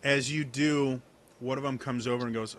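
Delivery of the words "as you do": usual speaking voice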